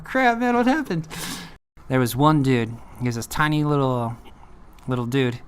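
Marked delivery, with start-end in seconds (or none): 0.0-1.1 high-pitched mock alarm; acted startled cellmate; alarmed reaction.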